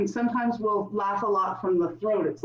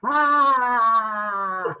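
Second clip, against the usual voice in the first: loud lion roar with tongue out; lion pose roar; 4 dB louder, much slower than usual. Shown as a demonstration. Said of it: "we'll do lion".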